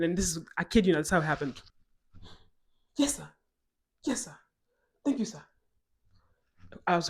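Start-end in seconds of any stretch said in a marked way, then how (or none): 3.0-5.4 short, high obedient replies; imitated dutiful officer on the phone; deferential cop. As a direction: answer a superior with snappy deference.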